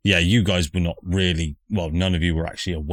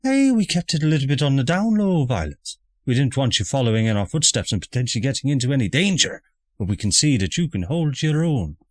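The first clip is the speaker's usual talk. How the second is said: posh mother voice; 8 semitones higher, faster than usual. Acted.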